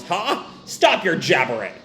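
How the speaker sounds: ordinary talk, nothing marked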